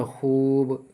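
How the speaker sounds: long, level oo in खूब; drawn-out vowel. Shown as a demonstration. Teacher shows how waw maroof is read, खूब with a stretched oo. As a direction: hold the oo of खूब, slightly sung out. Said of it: "खींच कर पढ़े वाव को".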